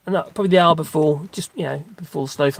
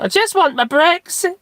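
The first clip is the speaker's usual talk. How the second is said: very high whiny plea; mimicking begging voice; 13 semitones higher, 3 dB louder than usual. Mimicking a Liverpool street interviewee begging. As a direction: squeak it out like a begging child. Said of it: "I started mimicking it".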